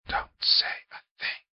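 hushed urgent whisper. A narrator's reading. Uncle hushing his grinning godson, in a whisper. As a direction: whisper so nobody else hears. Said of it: "whispered Unc".

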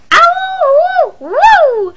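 high yelp of pain; faking pain. Retold or imitated. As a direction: yelp Ow hoo, high and loud. Repeats Nana yelping in pain.

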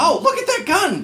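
high startled cry; surprised. Real, not acted. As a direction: react in sudden alarm. Alarmed at enemy weapon.

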